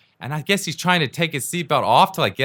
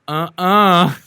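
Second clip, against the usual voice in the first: higher, louder mock gangsta "uh-uh"; sassy refusal; 5 semitones higher, 5 dB louder than usual. Imitating a defiant passenger facing down the taxi driver, sassy. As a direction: refuse with exaggerated sass. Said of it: "this gangsta".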